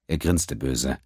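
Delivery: ordinary delivery, nothing marked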